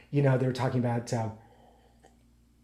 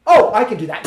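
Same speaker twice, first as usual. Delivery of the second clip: loud, high breezy claim; mock overconfidence; 5 semitones higher, 9 dB louder than usual. Watcher of a ballet dancer, mocked cocky confidence. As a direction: announce it as if it were nothing. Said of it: "you go like".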